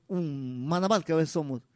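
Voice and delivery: ordinary delivery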